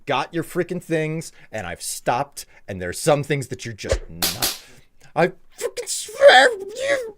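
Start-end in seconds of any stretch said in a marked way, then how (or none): 5.1-7.2 very high, loud frustrated shriek; shrieking outburst. Real, not acted. Streamer shrieking at trolling chat. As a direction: screech at chat in mock fury.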